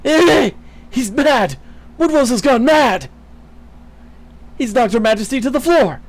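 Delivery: high, loud frantic yelling; panicked shouting. Acted. Bystander yelling as Woodwose attacks, panicked loud shouts. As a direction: scream for help in panic.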